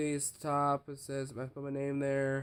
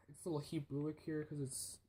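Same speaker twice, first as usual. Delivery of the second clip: sighing whisper; 8 dB quieter than usual. Real, not acted. Sighing over messy handwriting.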